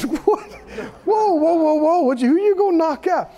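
high-pitched, rapid mock alarm; alarmed reaction. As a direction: act out startled disbelief, trying to stop him. Acts out his alarmed reply.

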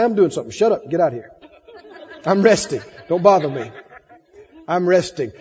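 low, unruffled brush-off; unbothered dismissal. Acted. Believer sending the devil off, low calm dismissal.

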